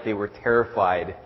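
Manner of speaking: ordinary talk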